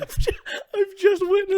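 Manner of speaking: high, laughing squeak. Real, not acted. Laughing at spit drink.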